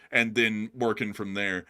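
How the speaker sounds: ordinary talk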